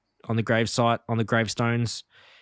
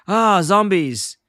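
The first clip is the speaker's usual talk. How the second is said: raised, drawn-out exclamation of alarm; louder, high mock cry of alarm; 8 semitones higher, 5 dB louder, much slower than usual. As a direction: cry out slowly in cartoon dismay. Retold cartoon character spots zombies.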